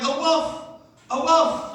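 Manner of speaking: high, yapping dog saying wolf twice; barking dog. Retold or imitated. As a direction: bark it out like a small dog. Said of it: "the dog went".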